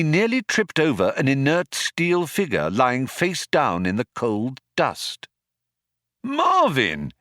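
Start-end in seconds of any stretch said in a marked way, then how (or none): 6.2-7.1 high, sudden startled exclamation; exclaimed. A narrator's reading. Ford finds Marvin face down, exclaiming high. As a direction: call out the robot's name in surprise. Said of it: "he exclaimed".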